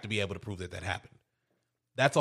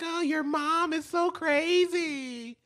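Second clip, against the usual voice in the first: much higher, slow put-on girly voice; mock other woman; slower than usual. Girlfriend mocking the wife to the husband, high put-on voice. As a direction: drawl it out like a catty girlfriend.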